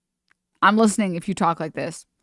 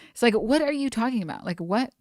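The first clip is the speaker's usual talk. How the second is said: ordinary talk, nothing marked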